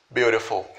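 Beautiful with the T tapped, beau-ri-ful; American flap T. Shown as a demonstration. Teacher models American pronunciation for learners, clearly. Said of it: "esse T ele vai ser transformado em R".

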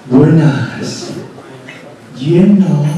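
drawn-out attendant greeting; gas station attendant. Acted. Attendant greeting a customer at the pump, drawn-out singsong. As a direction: play the attendant, stretching the words. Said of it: "llega la chica y te dice".

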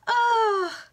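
high, loud "oh" after laughing; amused sigh. Real, not acted. Reader recovering from a laughing fit, amused high sigh. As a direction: let out a real high, amused "oh".

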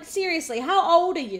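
ordinary talk, nothing marked

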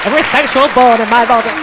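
put-on a loud, quick go at the singer's accent, a bit overdone; host fondly imitating the singer's accent; copying Kim's accent. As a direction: echo her line back in her accent, teasing. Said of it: "the way you say it in the accent".

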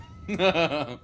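short burst of laughter; real laugh. Real, not acted. Streamer amused by crayon or cran, short real laugh. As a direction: let out a quick genuine laugh.

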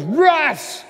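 furious high yell of a name; angry scream. Retold or imitated. Mad pastor calls out his staffer, angry scream. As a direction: bellow it like a boss at breaking point. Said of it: "he screamed".

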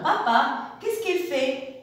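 ordinary delivery

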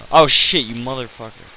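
loud startled cursing; sudden loud yelp, then a curse. Real, not acted. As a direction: jump in fright and swear. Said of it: "Scared the hell out of me".

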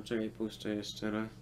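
ordinary delivery

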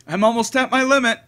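put-on a cornered girl pushing back; girl master voice.

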